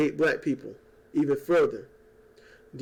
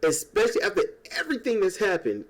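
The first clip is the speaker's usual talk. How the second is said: normal talking voice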